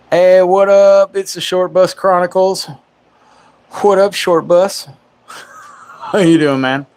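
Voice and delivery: loud, high, drawn-out hype welcome; excited greeting. Real, not acted. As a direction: shout out the channel like a host. Greeting viewers by name.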